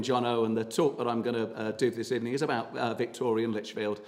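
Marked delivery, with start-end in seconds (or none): none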